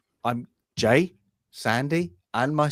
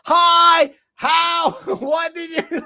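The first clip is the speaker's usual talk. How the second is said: much higher, louder, shouted over loud music; mock club shouting; 7 dB louder, much slower than usual. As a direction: yell it like you can't hear over the bass. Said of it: "they're like, 'Hi".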